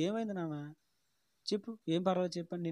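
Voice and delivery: soft, gentle coaxing; pitying mother. Retold or imitated. Mother gently asking her crying son what happened, soft and tender. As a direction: coax him tenderly to speak. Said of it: "జాలిగా అడిగేసరికి".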